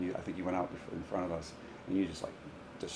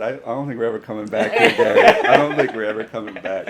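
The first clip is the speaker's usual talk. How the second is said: laughing; 3 semitones higher, 15 dB louder than usual. Real, not acted. Telling Gary, laughing.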